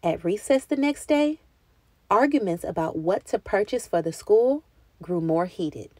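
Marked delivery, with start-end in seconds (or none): none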